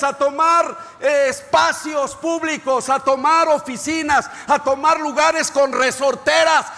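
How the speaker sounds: high, loud, sustained preacher's shout; impassioned pulpit cry, pitched high and driven hard; full-force preaching. Real, not acted.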